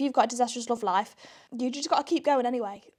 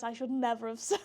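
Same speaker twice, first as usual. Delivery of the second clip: ordinary talk, nothing marked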